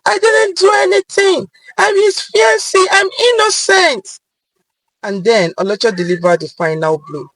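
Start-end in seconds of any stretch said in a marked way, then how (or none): 0.1-4.2 high, loud panicked protest; desperate plea. Acted. Arrested woman pleading with police, panicked and high. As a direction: cry out your innocence in panic.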